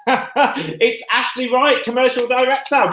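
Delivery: giggling through the name; laughing. Real, not acted. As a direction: laugh while you say it. Laughing while introducing guest.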